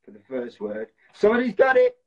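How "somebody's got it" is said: loud, high-pitched cry of real excitement; excited. Real, not acted. Host to players when somebody guesses the film, excited shout. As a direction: announce the winning guess, pitch and volume leaping up. Correct guess arrives in charades game.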